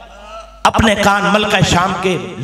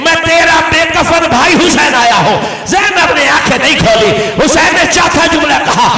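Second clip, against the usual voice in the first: full-force lament preaching; 3 semitones higher than usual. Acted. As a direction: cry the words out to the mourners.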